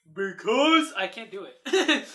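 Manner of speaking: slow, laughing, straining low; laughing deep voice. Real, not acted. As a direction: try to go deep but crack up. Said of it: "My voice can only go so low".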